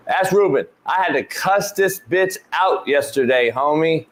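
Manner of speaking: ordinary talk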